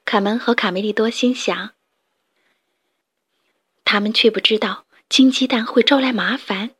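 plain reading voice

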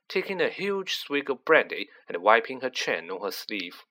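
plain reading voice

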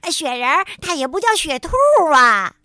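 loud, fast retort ending in a shout. A narrator's reading. Frog shouting back at rabbit in the argument, loud retort. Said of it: "跳跳的嗓门儿更大了".

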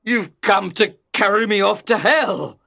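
loud cry of dread; horrified cry. A narrator's reading. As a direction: cry out in alarm, full voice. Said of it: "he cried".

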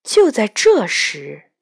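usual speaking voice

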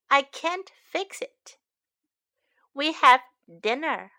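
ordinary talk

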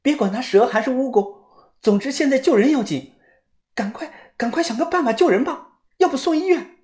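high, pressing plea; anxious. A narrator's reading. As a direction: hurry them to save him. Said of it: "小轩也非常的着急".

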